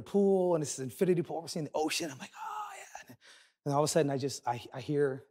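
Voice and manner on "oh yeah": breathed, voiceless sigh of relief; blissful relief. Retold or imitated. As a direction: let it out as a whisper, savoring the pool. Acted relief in infinity pool.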